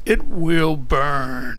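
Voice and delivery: loud, slow, drawn-out mock Australian; attempted Australian. Retrying Justin's special way of saying it, loud mock Australian. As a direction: stretch it out in a clumsy Australian imitation. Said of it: "I'm not Australian".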